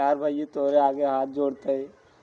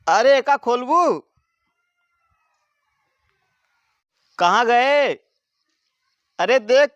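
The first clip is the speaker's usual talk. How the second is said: put-on loud, high drunken yelling; town drunk; 7 semitones higher, 6 dB louder than usual. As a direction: bellow like a groggy drunk waking up. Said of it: "कम नशा उतरत है".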